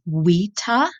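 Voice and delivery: vita said as wita. Shown as a demonstration. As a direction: say vita with a W, wee-tah. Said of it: "I'm pronouncing a V like a W".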